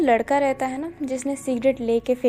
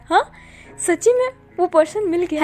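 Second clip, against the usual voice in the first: eager, higher question; excited; 5 semitones higher than usual. A narrator's reading. Boss hears the long-sought person is found, excited. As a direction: ask it thrilled and breathless. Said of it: "बहुत excited होके पूछता है".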